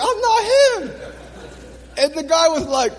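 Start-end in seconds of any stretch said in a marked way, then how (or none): 0.0-1.1 high protest. Retold or imitated. Protesting to arresting police.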